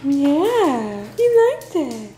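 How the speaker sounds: very high, excited praise; talking to a dog. Real, not acted. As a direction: gush at the dog.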